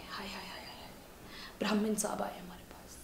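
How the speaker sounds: very soft, whispered exclamation; barely-voiced repeat of the welcome; hushed mock awe. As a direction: drop to a whisper as if awestruck.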